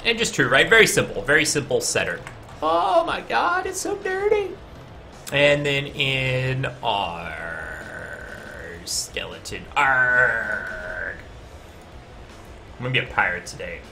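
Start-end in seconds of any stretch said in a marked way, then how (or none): 2.6-4.5 shrill mock shock. Mock shock at dirty code. 5.3-11.2 low slow pirate growl; lightly voiced pirate, dragged out. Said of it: "I'm just gonna talk like a pirate".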